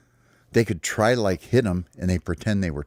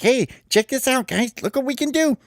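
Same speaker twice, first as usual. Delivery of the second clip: much higher, proud show-off chirp; excited high bird voice; 3 dB louder than usual. Acted. Sparrow shows the friends his new ball trick, excited high chirp. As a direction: squeak it out like a proud little bird.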